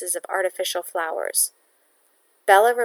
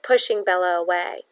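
ordinary delivery, nothing marked

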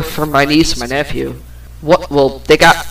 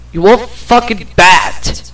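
put-on a higher, corrected "You old fucking bat", with the stress on "old"; retelling the corrected jab at her mother, pitched higher; corrected insult; 5 semitones higher than usual. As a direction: swap in "bat" and lean on "old". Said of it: "wait, let me correct that".

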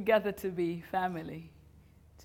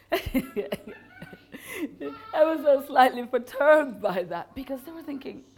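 laughing; 7 semitones higher, 6 dB louder than usual. Real, not acted. Repeats the line laughing.

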